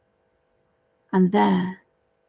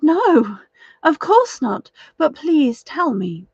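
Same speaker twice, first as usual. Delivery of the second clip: put-on higher, flustered young woman; flustered protest; 6 semitones higher, 4 dB louder than usual. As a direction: protest in a rush, pitched up high. Janet pleads with the stranger.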